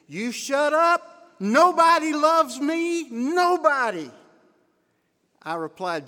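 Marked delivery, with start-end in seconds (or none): 0.1-4.1 higher, louder, slow furious yell; screaming teen. Retold or imitated. Angry teenage boy screaming at his counselor, loud and high. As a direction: scream it like a hurt, unloved kid. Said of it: "he got mad and he screamed at me".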